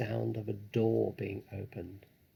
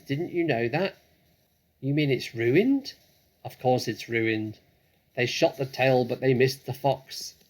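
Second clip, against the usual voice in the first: louder voiced calls between floors; loud calling; 3 semitones higher, 9 dB louder than usual. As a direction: call it up the stairs. Women calling across floors.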